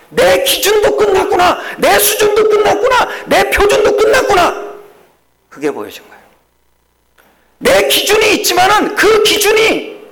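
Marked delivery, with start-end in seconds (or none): 0.1-4.7 high, loud preaching cry; preaching at full force. Acted. Pastor to congregation, crying out in full preaching force. Preacher shouting his climax. 7.6-10.0 high, loud preaching cry; preaching at full force. Acted. Pastor to congregation, crying out in full preaching force. Preacher shouting his climax.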